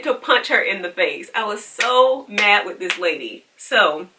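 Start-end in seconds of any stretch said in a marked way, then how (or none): none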